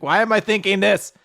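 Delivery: high, loud panicked self-questioning; acted frantic fluster. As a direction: blurt it out, catching yourself in alarm. Acts out flustered older brother.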